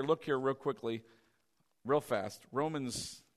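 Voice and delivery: ordinary talk, nothing marked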